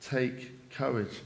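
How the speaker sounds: slow, soft reassurance; gently encouraging. A narrator's reading. Preacher passing on Jesus' words to the congregation, slow soft encouragement. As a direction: encourage them quietly, giving each word time. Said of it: "I want to encourage us".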